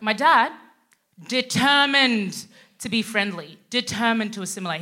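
normal talking voice

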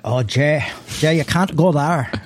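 put-on off-target Irish accent drifting toward Scottish. Dad's Irish friend calls to warn Jay, hammy Irish accent. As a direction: attempt an Irish friend's lilt, not quite landing it. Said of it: "my dad had this Irish friend".

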